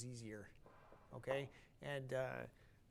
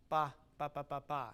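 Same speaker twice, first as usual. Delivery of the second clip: loud first "Ba", gap, then "ba, ba, ba, ba"; mechanical separation; 4 dB louder than usual. Shown as a demonstration. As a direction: detach the opening "Ba" from the triplet. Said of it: "it turns into a separation".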